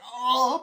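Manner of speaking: loud, high mock howl; acted pained "oh"; cry of pain. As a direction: yelp as if grabbed somewhere painful. Acts cry of grabbed man.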